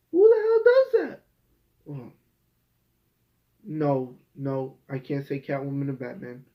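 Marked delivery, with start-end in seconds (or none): 0.1-1.2 much higher and louder, a disbelieving shriek; outraged shriek. Real, not acted. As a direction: screech it in total disbelief. Exasperated rant about the show.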